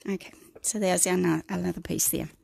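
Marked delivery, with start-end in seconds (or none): none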